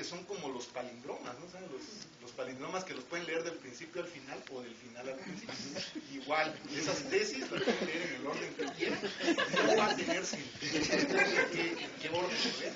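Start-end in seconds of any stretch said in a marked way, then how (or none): 6.1-12.7 laughing while joking. Real, not acted. Joking about unreadable theses.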